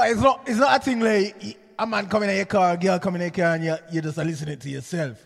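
usual speaking voice

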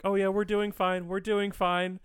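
high, sing-song fake politeness; high mock polite diner voice. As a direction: put on a sweet customer act. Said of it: "everyone's like".

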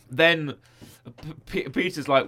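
usual speaking voice

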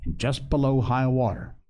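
usual speaking voice

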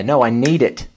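husband defiantly insisting he needs the armor. Retold or imitated. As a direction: push back hard, stand your ground. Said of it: "the bravery of the".

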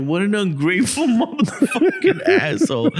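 high-pitched, cracking up mid-insult; mock outrage breaking into laughter; laughing disbelief. Friends walking away from ungrateful old man, laughing. Laughing while retelling their reaction.

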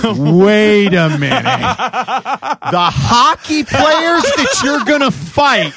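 slow, loud, high disbelief; incredulous. Retold or imitated. Questioning the friend about the hockey players, slow loud disbelief. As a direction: drag it out, stunned and rising. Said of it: "And I was like".

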